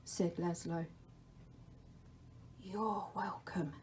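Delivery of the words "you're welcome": a soft, unvoiced whisper; the Dark's whisper. The Dark calls to Laszlo in a whisper. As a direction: breathe his name from the shadows.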